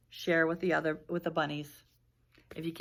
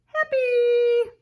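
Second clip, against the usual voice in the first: much higher, louder happy; cheerful exclaim for the kids; joyful; 10 dB louder than usual. A narrator's reading. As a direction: burst out with happy. Answer to what's that word.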